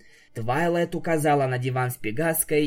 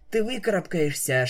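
usual speaking voice

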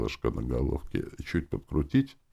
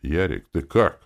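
usual speaking voice

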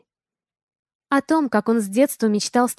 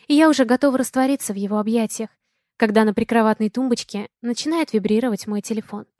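usual speaking voice

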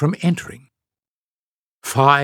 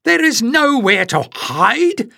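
high, slow protest; Jasmine despairing; 12 semitones higher, 3 dB louder, slower than usual. A narrator's reading. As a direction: stretch each word out as if no hope remains.

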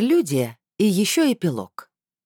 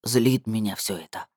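low, subdued man; lightly sighed grumble, pitched down; sighing male voice; 5 semitones lower, 3 dB quieter than usual. A narrator's reading. Man admits irritation to woman, lightly sighed low voice. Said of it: "вздохнул мужской голос".

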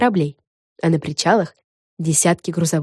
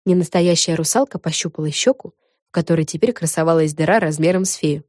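plain reading voice

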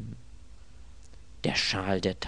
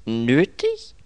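NÖTIG as a loud, high rising question; startled "Nötig?", pitched up; incredulous echo; 6 semitones higher, 8 dB louder than usual. Acted. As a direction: query "Nötig" in disbelief. Echoes the word in disbelief.

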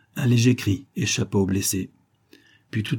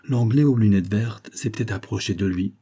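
plain reading voice

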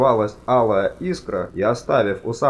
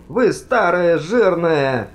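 loud, high, drawn-out insult; angry outburst; 8 semitones higher, 5 dB louder, slower than usual. A narrator's reading. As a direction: lash out at the old wizards. Panicked insult, cut short. (злой громкий выкрик)

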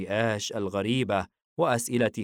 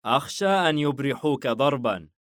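lightly amused, pitched up a little; amused father; 5 semitones higher, 4 dB louder than usual. A narrator's reading. Father amused by son's enthusiasm, lightly teasing. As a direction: tease the boy's eagerness. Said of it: "حايم ضاحكا لحماسه".

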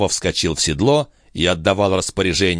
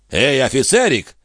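mocking hail, pitched up; mildly raised taunting call; 6 semitones higher than usual. A narrator's reading. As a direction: hail the officer with disdain. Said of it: "крикнул ему Жан".

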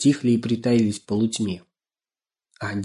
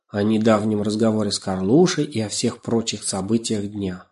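plain reading voice

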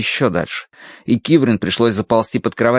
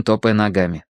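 ordinary delivery

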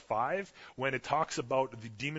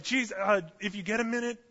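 casual polite ask; 8 semitones higher, 4 dB louder than usual. Retold or imitated. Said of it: "it's not like".

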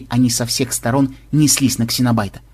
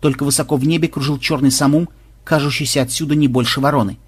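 ordinary delivery, nothing marked

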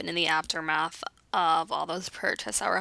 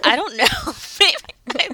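laughing; 9 semitones higher, 6 dB louder, slower than usual. Real, not acted. Laughs after being teased.

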